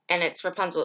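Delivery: ordinary delivery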